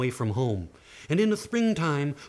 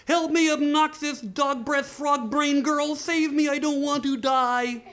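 high, drawn-out wail for help; desperate dwarf; 11 semitones higher, 3 dB louder, slower than usual. Acted. Dwarf begging the girls to save him, high slow wail. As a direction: stretch it out in high panic.

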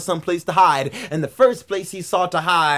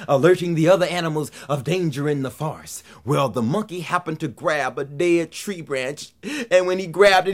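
usual speaking voice

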